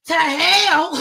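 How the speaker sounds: high, loud comic refusal; indignant outburst. Retold or imitated. Worker refusing supervisor's instruction, indignant outburst. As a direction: blurt it out in mock outrage.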